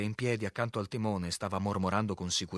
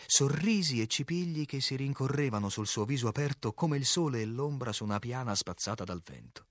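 ordinary delivery

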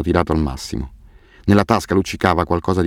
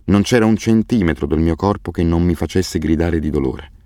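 ordinary delivery

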